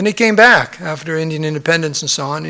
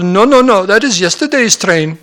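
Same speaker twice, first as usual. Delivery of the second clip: imitated Indian English, emphatic denial; Indian gentleman; 4 semitones higher than usual. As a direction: correct him as an older Indian gentleman. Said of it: "the Indian gentleman's there".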